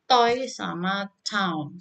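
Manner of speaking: "toy" and "town", said slowly with glides; teacher slowly models two diphthongs; slow diphthong words. Shown as a demonstration. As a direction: draw out both vowel glides. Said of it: "skill yang dua puluh".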